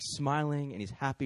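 ordinary delivery

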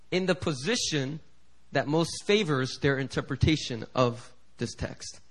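ordinary talk

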